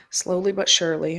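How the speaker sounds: ordinary delivery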